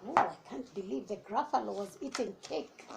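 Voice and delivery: usual speaking voice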